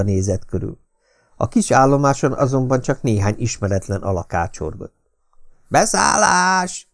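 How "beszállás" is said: long, high conductor's call; hoarse calling out. A narrator's reading. Conductor calling passengers aboard, hoarse drawn-out cry. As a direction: stretch it out high, hoarsely, without real force. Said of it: "ordította rekedten a kalauz".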